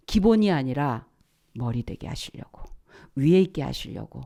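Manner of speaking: ordinary talk, nothing marked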